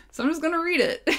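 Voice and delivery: laughing through the words; giggling as she admits it. Real, not acted. Laughing while explaining.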